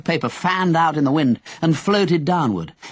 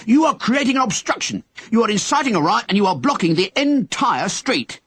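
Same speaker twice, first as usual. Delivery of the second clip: policeman bellowing; 8 semitones higher than usual. Acted. Policeman lecturing Henry at the door, bellowing. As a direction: bellow the charge at him. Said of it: "the policeman bellowed".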